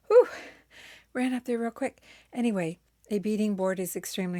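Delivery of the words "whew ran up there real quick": out of breath. Real, not acted. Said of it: "if I'm out of breath".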